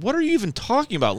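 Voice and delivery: fast, high-pitched incredulous retort; exasperated disbelief. Retold or imitated. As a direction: fire it back in retold exasperation. Retold argument over hospital.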